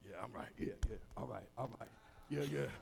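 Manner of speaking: low, soft man-to-man exchange; casual greeting. Acted. Two men greeting while hiding troubles, casual and low. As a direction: toss it off low and quiet.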